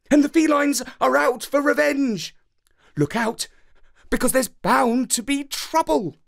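a dog fan gossiping before the final; excited dog lady. A narrator's reading. Dog lady explains the match.

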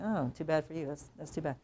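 soft, offhand commiseration; muted sympathy. Retold or imitated. Classmates react to someone caught in trouble, quietly offhand. Retold old-days reaction, low-key.

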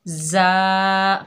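जा said firmly and held; strong, firm जा; जा with hardness; hard ja. Shown as a demonstration. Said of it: "ये सख्ती वाला हरूफ है".

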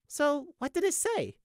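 slow, high, fishing question; high-pitched eager inquiry; perked-up interest. A narrator's reading. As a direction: draw it out with lifted curiosity. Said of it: "perked up in genuine interest".

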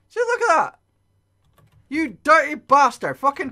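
high, loud indignant yelling; outraged shout. Real, not acted. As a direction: call out the cheating monster. Monster pisses on floors.

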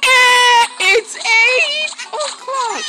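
high, loud, stretched announcement; mock alarm call. Alarm telling Yoshi the time, high loud call. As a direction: drag out the wake-up call.

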